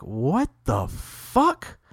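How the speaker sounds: slow, drawn-out what the fuck; baffled. Retold or imitated. Friend confused as the host backs off, slow and baffled. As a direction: let the disbelief sink in slowly. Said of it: "he's like".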